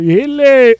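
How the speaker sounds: high, loud mock-French greeting; bad French accent. Streamer failing at French for the character, high mock-French accent. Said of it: "I can't do a good French accent".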